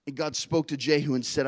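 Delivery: ordinary talk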